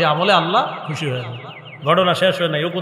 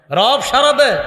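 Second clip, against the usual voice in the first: higher, louder declaration; preacher stressing the Lord answers; emphatic proclamation; 6 semitones higher, 6 dB louder than usual. Acted. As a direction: proclaim it strongly. Contrast line in sermon.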